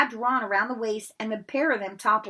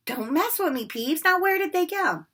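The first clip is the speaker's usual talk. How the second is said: louder, rising threat; furious Filch; 10 semitones higher, 5 dB louder than usual. Acted. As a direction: threaten him. Filch angry.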